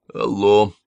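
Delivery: mock dying phone greeting. A narrator's reading. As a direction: answer as if barely alive, but audibly. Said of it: "ответили ей умирающим голосом".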